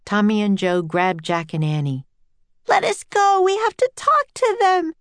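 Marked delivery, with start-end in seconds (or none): 2.7-4.9 very high, struggling protest; girl's yell. A narrator's reading. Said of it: "yelled Annie".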